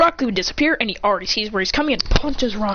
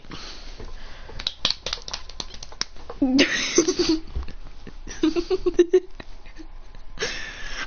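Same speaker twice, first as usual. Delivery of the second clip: long breathless wheeze of laughter; high, quiet helpless laughter; real laughing fit; 7 semitones higher, 6 dB quieter than usual. Real, not acted. Cracks up at Red Sprite.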